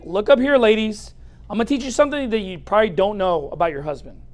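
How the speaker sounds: ordinary talk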